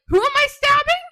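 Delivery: high, loud excited interjection; eager outburst. Retold or imitated. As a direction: jump in loudly, high-pitched and eager. Retold eager interjection, louder higher.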